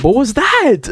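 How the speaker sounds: high-pitched, incredulous yelp; disbelieving outcry. Real, not acted. Gamer after a horrible layup, crying out in disbelief. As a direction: cry out in real disbelief at the play.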